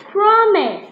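loud, high eager promise. A narrator's reading. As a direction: blurt out the promise. Meg promises earnestly.

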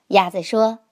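plain reading voice

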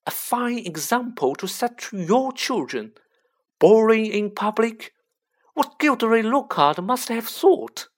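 high, loud scolding; furious. A narrator's reading. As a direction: tear into him, furious. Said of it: "beside herself with fury".

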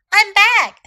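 high, loud, triumphant return; excited announcement. Acted. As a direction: announce yourself brightly and loudly. Maud announces her return.

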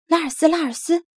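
high, loud eager calling; puppy has found a boat, calling excitedly. Acted. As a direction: call him over, bursting with excitement. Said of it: "纳努克激动地喊".

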